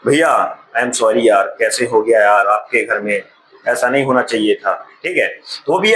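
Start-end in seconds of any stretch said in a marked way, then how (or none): 0.1-4.9 mock sympathy. Second student consoling the grieving friend, lightly mock sympathetic. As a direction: say sorry for his loss with a half-laugh. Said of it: "ये भी बोला कि".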